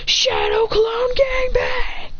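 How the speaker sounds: high, drawn-out attack yell; battle cry. Acted. Fighter unleashes all his clones on John, battle cry. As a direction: stretch the move's name into a high anime yell.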